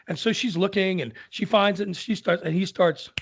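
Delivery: plain reading voice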